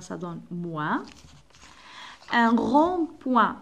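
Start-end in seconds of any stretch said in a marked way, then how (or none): 2.3-3.6 Un rond-point drawn out; slow model word. Shown as a demonstration. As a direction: stretch Un rond-point for pupils. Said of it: "P avec wa". (Un rond-point ببطء)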